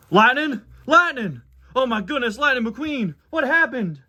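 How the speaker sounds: high-pitched, alarmed country tow truck; Tow Mater. Acted. Mater finds his crashed best friend, alarmed. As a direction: cry out in distress for a wrecked buddy. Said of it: "Tow Mater Tries to Convince Someone".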